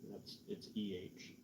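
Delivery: ordinary talk, nothing marked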